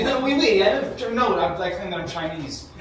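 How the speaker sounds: a hint of a mock French accent on "oui, oui". Shown as a demonstration. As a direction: tease with a silly French accent. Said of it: "it's a fucking French accent".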